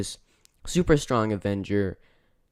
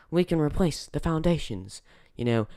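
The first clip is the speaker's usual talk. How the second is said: a faintly put-on accent, barely there; 轻描淡写的蹩脚口音模仿; terrible Korg accent; 5 semitones higher than usual. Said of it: "excuse my terrible accent".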